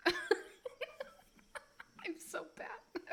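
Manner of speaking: real helpless giggling through the words; can barely talk for laughing. Real, not acted. Cracking up at own accents.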